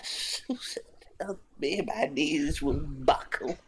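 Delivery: slow, breathless whispered plea; weak whisper. Acted. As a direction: whisper it like you're about to collapse. Same plea redone weakly.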